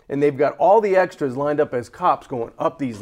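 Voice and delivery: ordinary talk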